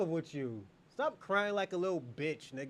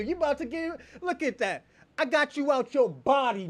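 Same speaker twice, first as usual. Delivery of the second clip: ordinary delivery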